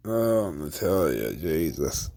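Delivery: low, slow, quiet groan; sinking, fed-up mumble; weary dismay. Real, not acted. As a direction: sigh the name in exasperation. Dismayed at mention of Natalya.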